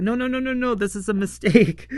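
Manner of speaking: hammed-up flustered pleading; mock panic. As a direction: rattle off the denials in comic panic. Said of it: "And Shoba's all panicky".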